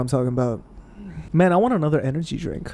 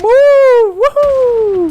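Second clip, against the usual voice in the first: high, loud triumphant woohoo; excited whoop; 8 dB louder than usual. Real, not acted. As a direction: cheer like you finally landed it. Celebrating a finishing move.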